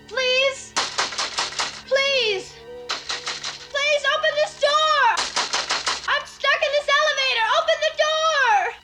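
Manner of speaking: panicked pleading. Acted. Trapped, pleading for help.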